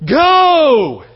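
loud, high battle shout. Acted. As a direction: yell it as you hurl him. Acting out throwing the idiot.

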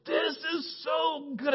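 high, slow, stretched-out marvelling; drawn-out awe; overwhelmed delight. Retold or imitated. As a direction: savour every word in high-pitched delight. Retelling his tearful delight.

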